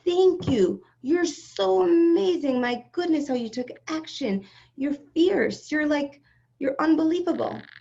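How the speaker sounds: lavish her with delighted praise; gushing praise. Retold or imitated. Inner divine voice celebrating her, gushing. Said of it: "I heard Hashem saying to me".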